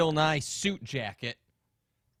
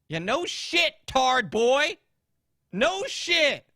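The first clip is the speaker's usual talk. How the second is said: much higher and louder, snapping at a troll; mocking furious shout at a commenter; angry yell; 8 semitones higher, 6 dB louder, slower than usual. Real, not acted. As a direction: bark it at the idiot in chat. Yelling at a chat troll.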